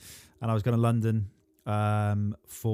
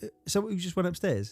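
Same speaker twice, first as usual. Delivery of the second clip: puzzled question; 9 semitones higher than usual. Retold or imitated. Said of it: "I went".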